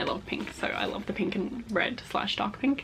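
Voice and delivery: voice giving out. Real, not acted. Vlogger running out of voice near the end of filming, fading whisper. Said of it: "I can feel my voice disappearing".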